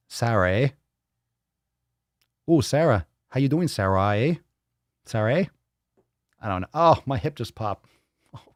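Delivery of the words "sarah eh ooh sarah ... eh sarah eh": playful Canadian with rising "eh"; Canadian accent. Acted. Host teases Papa's way with names, mock Canadian accent. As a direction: tag a Canadian "eh" on lightly. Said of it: "I guess is the Canadian accent".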